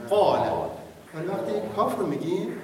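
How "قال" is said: قال said loud and lower with an open vowel; Arabic demo. Shown as a demonstration. Teacher repeats the model word, loud and low. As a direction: drop and punch قال.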